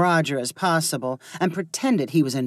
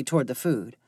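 ordinary delivery, nothing marked

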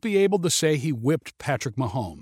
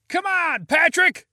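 crowd shout; slower than usual. Acted. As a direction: yell it across the street. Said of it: "shouted people in the crowd".

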